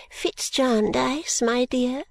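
put-on high, slow, fussy old lady greeting guests; an eccentric old woman, honoured and gracious; fluttery old lady. Old Miss Flite welcomes the wards, high and slow.

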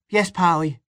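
put-on high, obedient child voice; little boy. A narrator's reading. Little boy obeys his father, high child voice.

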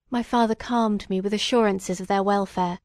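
ordinary delivery, nothing marked

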